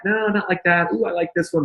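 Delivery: high-pitched mock client reacting; picky client. Client giving designers feedback on logos, high-pitched picky reactions. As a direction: pitch it up, like a choosy customer.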